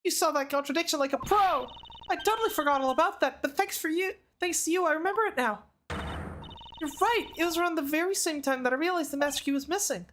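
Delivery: high, fast eager guard voice; Gumshoe's twin sister. Acted. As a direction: rattle it off like a flustered rookie guard. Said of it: "Gumshoe's, like, secret, like, long-lost twin sister".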